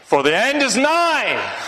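high, slow doom cry; yelled warning. Retold or imitated. Street preacher yelling at a passing boy, a slow high cry. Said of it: "he suddenly yelled out".

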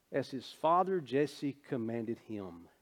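ordinary talk, nothing marked

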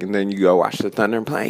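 normal talking voice

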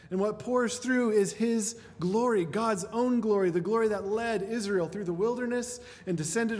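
impassioned preacher building to the high point; rising sermon climax. Acted. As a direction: lift the congregation toward the glory.

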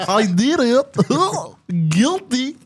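high goofy giggle then a mock guilty confession; goofy laugh. Shown as a demonstration. Laughing on request from his co-host, goofy and high. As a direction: laugh like a goof and own up. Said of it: "You do. Do it again".

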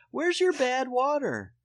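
put-on high, slow sing-out kid question; imitated little girl. Dad imitates daughter asking about his soda, childlike. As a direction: draw it out loud like a nosy child. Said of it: "She said".

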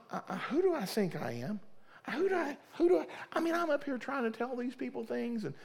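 high, soft, strained cry to God; crying at the altar, who do I think I am; anguished prayer. Retold or imitated. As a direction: plead at the altar, voice breaking high. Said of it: "I'm like, Lord".

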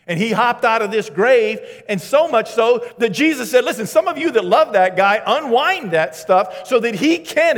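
loud, high fired-up sermon voice; full-force pulpit delivery; impassioned preaching. Acted. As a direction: preach it hard to the crowd. Preaching climax, rising intensity.

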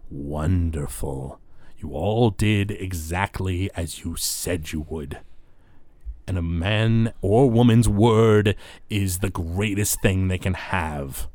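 slow, deliberate character praise; Zalaman the Wretched. Acted. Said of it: "Zalaman the Wretched turns".

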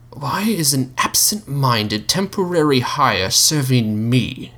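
slow, high, disdainful question; the Mouth's slow demand. Acted. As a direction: look down on the waiter. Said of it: "he inquired".

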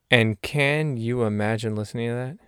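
normal talking voice

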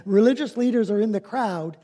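ordinary talk, nothing marked

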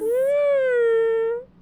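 high, loud cheer; real excited whoop; excited woo. Real, not acted. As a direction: whoop for your friend. Cheering a friend.

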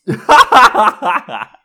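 sudden loud squeal of laughter; loud high laugh. Real, not acted. Host reading the egg option again, bursting into loud laughter.

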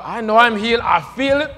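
a touch of triumphant shout; excited retold cry. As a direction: shout it like she just jumped up healed. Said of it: "Immediately she jumped up".

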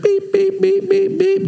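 high-pitched electronic beeps; imitated scanner beep. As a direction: mimic a card reader beeping. Imitating rapid repeated card scans.

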